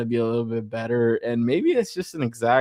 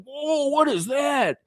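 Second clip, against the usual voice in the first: amazed onlooker; 13 semitones higher, slower than usual. Retold or imitated. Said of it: "people are gonna be like".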